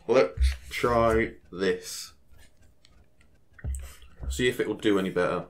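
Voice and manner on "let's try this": drawn out. Real, not acted. Gamer repeats a line slowly.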